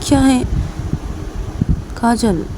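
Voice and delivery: Reshma coaxing. Acted. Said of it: "Reshma coffee पीते पीते ऐसे काजल को बोलती कि".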